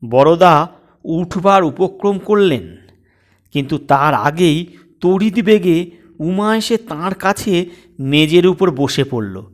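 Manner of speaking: usual speaking voice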